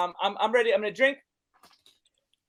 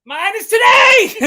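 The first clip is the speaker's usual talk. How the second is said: high, loud triumphant shout, drawn out; excited shout; 11 semitones higher, 11 dB louder, slower than usual. Real, not acted. Host gleefully shouts that his birthday is today. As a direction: yell it out with delight.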